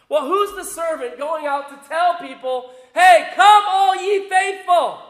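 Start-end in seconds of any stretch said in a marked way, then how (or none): 2.9-5.0 high, loud, drawn-out summons; big high-pitched proclaiming call. Acted. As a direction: call the crowds in from the street. Acts servant calling people in.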